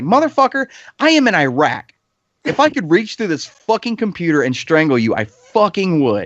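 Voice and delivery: retold furious reply from Iraq; furious retort. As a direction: snap back at him in a rage.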